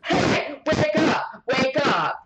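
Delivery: imitating boyfriend shouting, high and loud; retold wake-up yell. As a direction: bark it like jolting someone awake. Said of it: "he goes".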